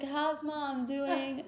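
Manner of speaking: soft, very slow groggy question; soft and very slow, like just coming round; dazed question. Retold or imitated. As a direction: ask it half awake. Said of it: "she's like".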